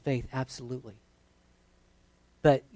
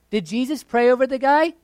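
the question again, much higher and louder; preached loud; 8 semitones higher, 8 dB louder than usual. Real, not acted. Preacher challenging the congregation, loud and high. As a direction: hammer the question home to the crowd.

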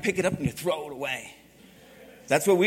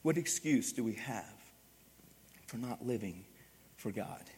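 ordinary talk, nothing marked

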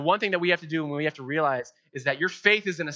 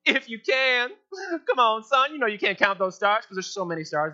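God's teasing tone; 7 semitones higher than usual. Pretend. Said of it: "I can just imagine God's tone".

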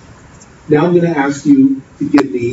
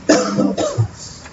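tender hush; 3 semitones lower, 4 dB quieter, much slower than usual. Acted. Tender close over music.

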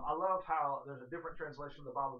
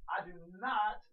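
"not" pushed higher and louder; stress on "not"; 6 semitones higher, 7 dB louder than usual. Shown as a demonstration. Preacher quoting Jesus, heavy emphasis on "not". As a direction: lean hard on "not". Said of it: "there's the emphasis on the word there".

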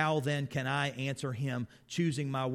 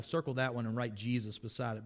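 ordinary delivery

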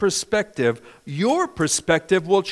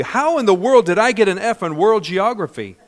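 loud question; 4 semitones higher, 8 dB louder than usual. Real, not acted. Preacher's loud rhetorical question.

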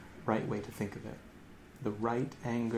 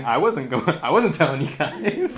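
mock denial; 3 semitones higher, 8 dB louder than usual. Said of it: "It's almost like he's saying".

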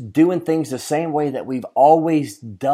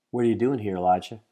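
God asking quietly; 4 semitones lower, 7 dB quieter, much faster than usual. Retold or imitated. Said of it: "So God asks Elijah again".

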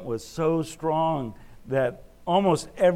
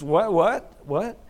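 higher, louder puzzled what, what, what; mock-baffled, asking again and again; bewildered what; 6 semitones higher, 5 dB louder, slower than usual. A visitor lost in the farmers' country store chatter, mildly bewildered. Lost in thick accent talk.

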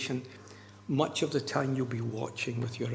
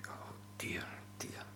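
very soft, barely voiced sigh of reluctance; hushed dread; 17 dB quieter than usual. Pastor dreading a hard visit, hushed and reluctant. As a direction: groan it softly under the breath.

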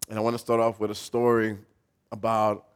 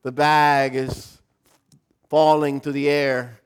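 drawn-out slow-motion narration, raised voice; slow, louder, suspenseful play-by-play of a fall; 2 semitones higher, 6 dB louder, slower than usual. Acted. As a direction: stretch each word as if time slows. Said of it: "And in slow motion".

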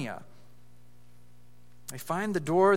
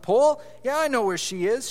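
shouting out; 6 semitones higher than usual. Acted. A schoolmate calling out to the pursuers, shouting. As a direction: yell it across the gym. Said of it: "a voice from the other side of the gym was heard shouting out".

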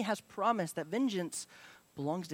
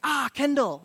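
sudden loud high yelp of a name; loud, higher mock exclamation; mock cry out; 6 semitones higher, 8 dB louder than usual. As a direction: blurt the name like you stubbed your toe. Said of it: "you just scream his name".

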